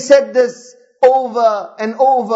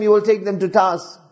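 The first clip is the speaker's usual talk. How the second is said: usual speaking voice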